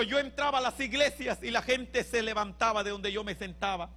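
normal talking voice

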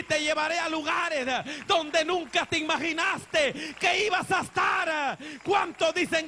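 predica a pleno pulmón, con gritos; predicación ferviente. Acted. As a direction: exhorta con fuerza a la congregación. Predica con fuerza al final. (el predicador anima a los hermanos, a gritos)

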